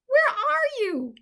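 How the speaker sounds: high, loud, slow call; lost mouse calling into the forest, high loud. A narrator's reading. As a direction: search the forest with your voice. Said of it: "Michaela cried out".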